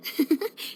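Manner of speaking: short soft giggle at her own joke; light chuckle. Acted. Joking about her long title.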